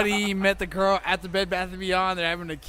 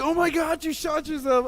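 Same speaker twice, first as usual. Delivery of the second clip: much higher, alarmed cry; shocked shout; 11 semitones higher than usual. Retold or imitated. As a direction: gasp it out high and shocked. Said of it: "He's like".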